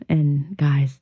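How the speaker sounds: ordinary talk, nothing marked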